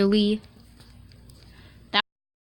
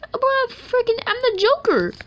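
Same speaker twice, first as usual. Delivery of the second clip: high yelled declaration; 14 semitones higher, 5 dB louder than usual. Pretend. Shouting a joke line.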